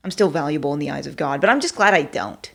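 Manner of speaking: normal talking voice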